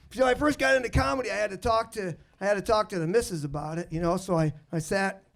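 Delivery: usual speaking voice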